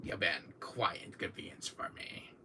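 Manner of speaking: whispered smug villain; Wesker impression. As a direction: hiss it coldly, barely voiced. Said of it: "like Wesker does".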